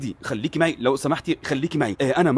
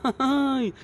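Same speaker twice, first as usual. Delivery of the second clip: scoffing laugh; 10 semitones higher than usual. Acted. Scoffing laugh at brother's claim.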